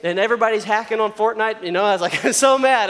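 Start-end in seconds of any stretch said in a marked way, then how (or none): 2.2-2.9 loud, high outburst of pretend anger; hammed-up exasperation; mock mad. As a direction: blurt it out in mock fury. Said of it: "That's, like, what it feels like".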